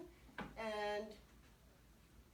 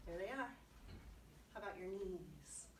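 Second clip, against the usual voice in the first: ordinary delivery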